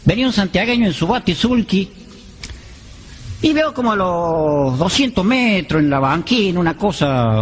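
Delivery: normal talking voice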